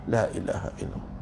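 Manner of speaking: barely voiced, whispered la ilaha illallah; whispered tahlil. Preacher mimics guests mumbling tahlil, whispered. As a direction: breathe the words with no voice, like a hungry guest mumbling.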